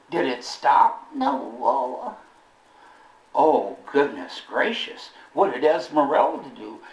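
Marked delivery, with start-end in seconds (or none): none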